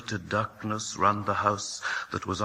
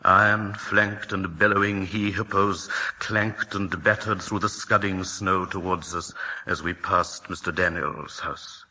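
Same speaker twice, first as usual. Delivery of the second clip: usual speaking voice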